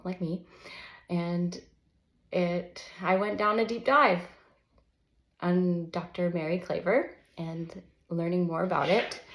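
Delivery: usual speaking voice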